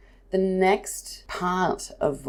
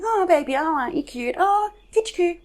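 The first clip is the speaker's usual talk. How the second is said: put-on much higher sing-song coo at a baby; cooing baby talk; 13 semitones higher, faster than usual. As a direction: gush over a cute baby. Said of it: "are you going".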